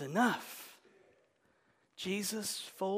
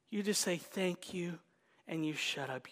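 ordinary delivery

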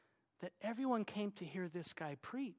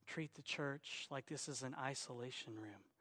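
usual speaking voice

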